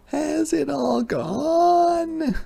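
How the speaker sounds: high, slow hopeful hedgehog voice. A narrator's reading. Hedgehog asks after rolling, high and hopeful. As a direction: ask it full of hope. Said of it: "she asked, hopefully".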